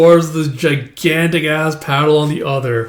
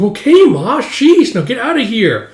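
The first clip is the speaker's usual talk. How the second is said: much higher, whiny protest; exasperated son; 8 semitones higher, 3 dB louder than usual. Acted. Embarrassed son to his fussing mother, exasperated. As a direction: shoo your mother away, mortified.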